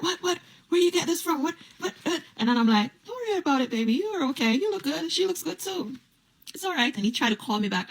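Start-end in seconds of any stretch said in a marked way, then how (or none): 0.0-2.2 mock flustered man. Man stammering in retold quote. 3.1-6.9 high, sugary teasing voice, softer; mock sweet reassurance. Teasing the cheating boyfriend in a sugary high voice.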